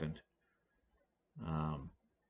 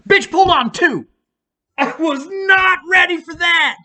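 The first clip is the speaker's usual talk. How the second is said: much higher, louder indignant yelling; outraged shout; 14 semitones higher, 9 dB louder than usual. Real, not acted. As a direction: shout it out in disbelief. Outraged at the early yank.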